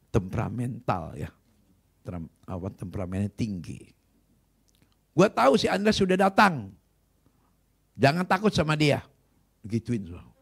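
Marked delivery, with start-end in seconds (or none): 5.1-9.0 loud, high, scornful outburst; rude shout. Retold or imitated. Preacher mimicking the rival pastor's rude shout. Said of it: "Pendeta tersebut teriak, ngomongnya kasar lagi".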